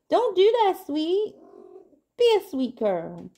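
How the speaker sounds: sharp high reprimand to a pushy dog; scolding the pet. Real, not acted.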